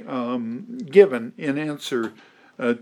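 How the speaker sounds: ordinary talk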